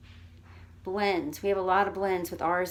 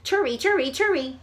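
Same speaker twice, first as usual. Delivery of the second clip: "Tur-ee" fired off quickly, higher and louder; fast and loud; 6 semitones higher, 7 dB louder than usual. Shown as a demonstration. Teacher racing the timer, fast and loud. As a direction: beat the bell, rapid. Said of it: "in one second".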